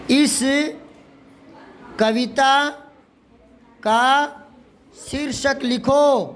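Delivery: word by word, long pauses between; dictation. Shown as a demonstration. Teacher dictating to writing pupils, word by word. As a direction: dictate slowly, stretching the last phrase. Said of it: "तो लिखो".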